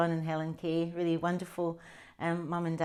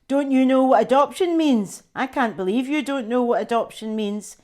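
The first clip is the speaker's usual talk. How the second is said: louder, higher scornful big brother; scoffing brother; 6 semitones higher, 10 dB louder than usual. Acted. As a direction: scoff at her like a know-it-all sibling. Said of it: "My brother scoffs".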